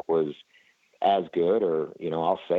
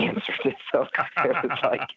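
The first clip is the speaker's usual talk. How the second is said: laughing; 8 semitones higher than usual. Real, not acted.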